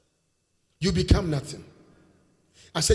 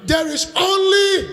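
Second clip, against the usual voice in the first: call to congregation; 10 semitones higher, 5 dB louder than usual. Shown as a demonstration. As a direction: shout it for the church to repeat. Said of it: "shout it".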